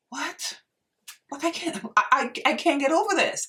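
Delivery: high, squeaky can't-believe-it; excited disbelief. Real, not acted. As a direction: gush with rising disbelief. Marvelling at her own skin.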